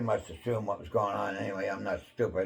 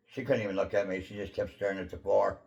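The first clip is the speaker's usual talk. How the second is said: ordinary talk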